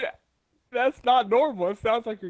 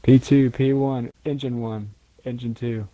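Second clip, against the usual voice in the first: slow flat callout; 2 semitones lower, 3 dB louder than usual. Shown as a demonstration. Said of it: "You just sound emotionless".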